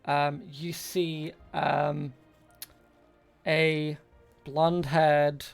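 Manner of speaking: usual speaking voice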